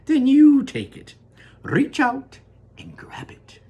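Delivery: high, loud, slow then hushed; Wonka coaxing. Acted. Wonka invites Charlie to reach out, loud then hushed. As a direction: coax him, then drop to a whisper.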